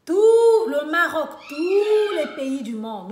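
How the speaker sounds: "tout" and "tous" drawn out, loud and high; emphatic "tout" and "tous". Real, not acted. Vlogger capping a list of countries, "tout" and "tous" stretched emphatically. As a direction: belt out a long "tout", then a long "tous".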